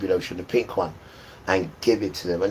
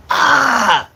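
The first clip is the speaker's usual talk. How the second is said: sudden high, loud yelp of pain; 11 semitones higher, 12 dB louder than usual. Retold or imitated. Boy in the toilet yelping in sudden pain. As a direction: cry out sharply as it hurts.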